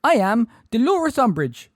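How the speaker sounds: high, loud, frightened self-introduction; Umbridge, high-pitched and terrified. A narrator's reading. Umbridge facing armed centaurs, high-pitched terror. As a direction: announce yourself through terror. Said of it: "in a high-pitched, terrified voice".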